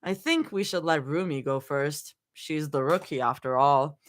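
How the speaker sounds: low, dry playful tease; dry but playful. Acted. Mira teasing the rookie Rumi, dry but playful. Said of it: "dry but playful".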